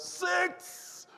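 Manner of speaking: high-pitched imitation of a cheering yell; excited yell. Preacher imitating a Jamaican cricket fan at baseball, excited yell. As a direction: yell it like a thrilled fan jumping up. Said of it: "he jumps up and he yells".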